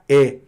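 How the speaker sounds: "é" with an open vowel. Shown as a demonstration. Teacher sums up the Portuguese acute accent, open vowel. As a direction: land on a wide-open "é". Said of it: "som aberto".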